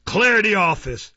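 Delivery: high, loud dismissal; Mister Fang, shouted order. A narrator's reading. Magistrate ending the case, shouting an order. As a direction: shout the room empty.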